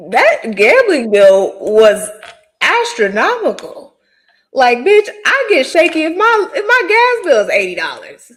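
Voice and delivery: loud, high-pitched disbelief at the amount; loud exclaiming. Real, not acted. Cohost reacting to a huge gambling bill, loud exclaiming. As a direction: punch it out loud, pitch leaping up.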